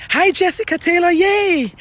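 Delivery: high, loud happy hello; bright upbeat welcome, pitched high; excited greeting. Real, not acted. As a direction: cheer as a viewer shows up. Greeting a viewer who appeared.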